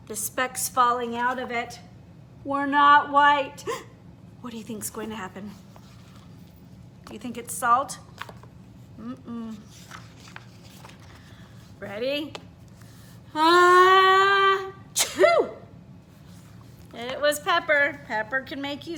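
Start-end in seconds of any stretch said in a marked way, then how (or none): 3.7-4.4 quick hushed gasp; mock gasp. Reader gasps at pepper. 13.3-15.5 loud, high hammed-up sneeze; ah-chew cartoon sneeze; big sneeze. Hamster sneezes from pepper.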